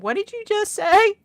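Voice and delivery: loud, high incredulous question; mock amazement shooting up at the end; astonished. Wyoming listeners hearing an odd phrase, loud mock astonishment. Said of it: "they said".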